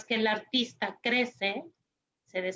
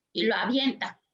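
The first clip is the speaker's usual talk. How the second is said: ordinary talk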